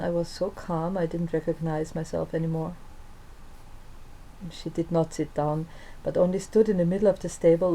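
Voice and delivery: usual speaking voice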